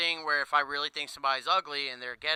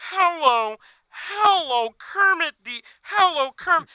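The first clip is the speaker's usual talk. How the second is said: much higher, loud overdone frog voice; over-the-top Kermit; 12 semitones higher, 8 dB louder, slower than usual. Retold or imitated. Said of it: "you gotta bring it down some".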